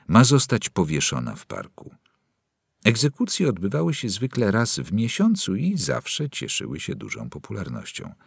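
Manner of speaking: ordinary delivery, nothing marked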